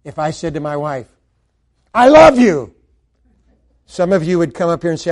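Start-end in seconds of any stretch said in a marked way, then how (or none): 1.9-2.7 shouted high and loud I love you; loud, overblown I love you; loud declaration. Shown as a demonstration. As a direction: blurt love out loud like a challenge. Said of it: "it's the manner in which we say that communicates".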